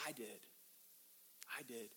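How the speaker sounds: very soft, low admission; acted hushed confession; timid confession. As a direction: own up meekly, barely audible. Woman timidly owns up.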